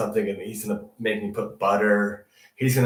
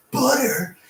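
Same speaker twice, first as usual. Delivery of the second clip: amazed; 10 semitones higher, 5 dB louder, slower than usual. Retold or imitated. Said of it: "'cause I was like".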